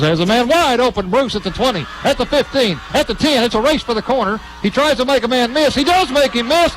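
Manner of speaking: usual speaking voice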